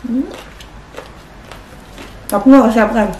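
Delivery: talking while chewing; muffled chewing speech; mouth full chewing. Real, not acted. Seen 2: Bit food at -2s; closed lips, chewing through labelled words.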